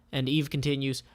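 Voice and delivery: ordinary delivery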